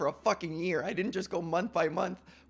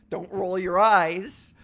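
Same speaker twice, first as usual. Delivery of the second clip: loud, slow mock scold; playful scolding; 3 semitones higher, 8 dB louder than usual. Real, not acted. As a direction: call him out, drawn out and loud. Scolding co-host for eye roll.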